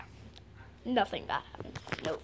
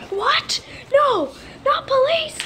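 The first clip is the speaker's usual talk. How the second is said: dismayed cry; 6 semitones higher, 6 dB louder, slower than usual. Acted. Villain dismayed at police.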